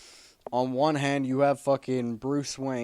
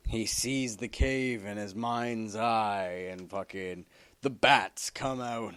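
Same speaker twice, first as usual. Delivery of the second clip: ordinary talk, nothing marked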